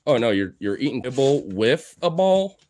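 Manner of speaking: ordinary talk, nothing marked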